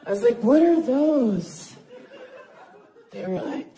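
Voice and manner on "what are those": slow, louder, laughing disbelief; mock shock. Comic to hotel staff, slow laughing disbelief. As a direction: drag it out in stunned laughter.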